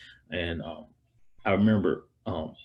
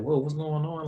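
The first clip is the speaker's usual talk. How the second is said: higher, bewildered whoa; dumbfounded; 9 semitones higher than usual. Retold or imitated. Son recalling his mother's cold reaction, bewildered. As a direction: sound lost and confused.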